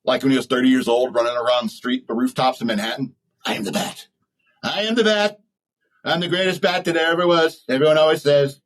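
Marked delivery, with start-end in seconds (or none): 3.4-4.1 breathy Batman-style whisper as Trump; growl-whisper it like a pretend caped hero; hushed mock Batman line. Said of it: "Could you imagine Trump". 4.6-8.6 a light Trump boast, higher; hammed-up Trump superhero boast; mock Trump as Batman. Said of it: "Could you imagine Trump".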